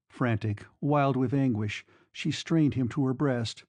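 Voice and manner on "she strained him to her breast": plain reading voice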